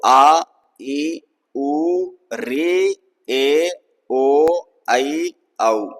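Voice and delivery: each vowel आ, ई, उ held for two beats; two-beat vowels said slowly, one by one; long vowels. Shown as a demonstration. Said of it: "इनका थोड़ा सा उच्चारण लंबा होता है".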